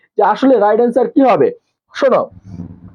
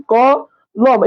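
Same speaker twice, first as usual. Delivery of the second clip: ক, a pause, then লম as one beat; syllable by syllable; 2 semitones higher, much slower than usual. Shown as a demonstration. Teacher showing students where the breath pushes, two separate syllables. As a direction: split the word into ক and লম. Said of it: "যখন আমরা উচ্চারণ করি".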